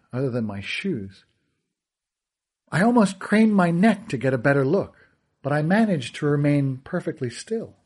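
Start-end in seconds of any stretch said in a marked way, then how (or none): none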